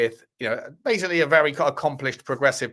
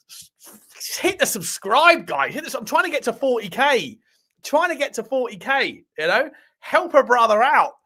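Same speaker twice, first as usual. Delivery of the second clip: pleading; 9 semitones higher, 3 dB louder than usual. Pretend. Pleading for subscribers.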